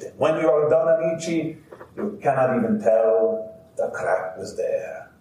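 put-on grandfather telling his story in heavy Italian accent. Grandfather to the audience, heavy Italian accent. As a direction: play the old Italian carver proudly recounting. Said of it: "heavy kind of Italian accent".

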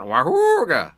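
loud, high honking horn noise; truck horn imitation. Trucker pulling on his horn, loud mock horn honk. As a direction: honk it like a big rig horn.

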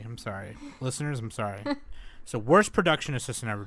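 ordinary delivery

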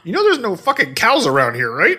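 high-pitched, pointed disbelief; mock incredulous wife. Co-host voicing the wife doubting her husband, mock incredulous. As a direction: imitate a wife calling out nonsense. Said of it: "she was probably looking at him like".

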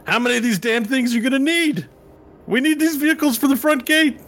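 very high, strained incredulous complaint. Acted. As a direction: squawk it in disbelief at a high, held pitch.

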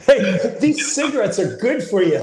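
high, bright-sold announcer pitch, laughing; chipper 1950s ad. Pretend. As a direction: sell it like an old cigarette ad. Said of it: "the T V commercials for".

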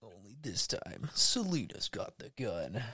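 soft, slow, ominous hush; a movie-trailer voice dropping low; mock-dramatic whispered teaser; trailer tagline.

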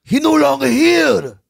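high, loud, slow broken-English dad voice; hammed-up curt father with an accent; imitating dad. Voicing his dad in story.

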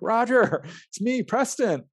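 high, slow call with a laugh; calling out to a friend, half laughing. Retold or imitated. Calling out to his friend Roger.

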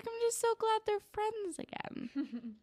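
high, slow, touched squeal; touched gushing. Real, not acted. Host gushing that the characters are friends again, touched. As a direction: gush softly in a high, drawn-out voice.

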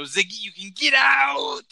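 high, loud, slow shout. Acted. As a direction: stretch the yell out. Get out catchphrase shout.

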